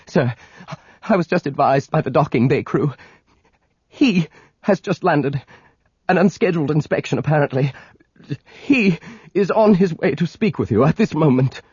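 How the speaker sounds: nervous aide bringing bad news; frightened aide. A narrator's reading. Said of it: "eyes wide and frightened".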